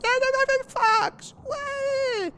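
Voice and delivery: put-on squealing very high kid, near crying with joy; excited kid. Streamer playing a kid opening the game, high squeal. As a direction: shriek it like an overjoyed child. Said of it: "It's like".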